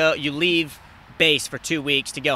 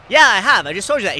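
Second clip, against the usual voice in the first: higher, louder oblivious reply; loud confused yes; 6 semitones higher, 8 dB louder than usual. Retold or imitated. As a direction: insist cluelessly. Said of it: "I was like".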